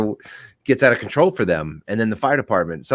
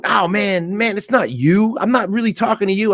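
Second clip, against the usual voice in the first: high, slowed backpedalling voice; mock street guy; 12 semitones higher than usual. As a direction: play the guy caught out, nervously backing off. Voicing the guy in the road.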